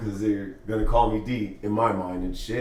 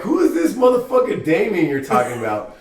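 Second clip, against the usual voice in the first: higher, louder indignant retort; mock outrage; 8 semitones higher, 6 dB louder than usual. In bed, he angrily asks the girl who Damien is. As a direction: demand an answer, mock offended. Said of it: "I'm like".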